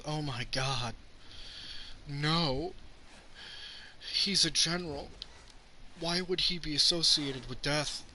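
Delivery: mock naive Supergirl. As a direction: play her as shocked and innocent. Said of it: "and she goes".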